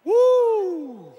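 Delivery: excited whoop. Real, not acted. Preacher exclaims.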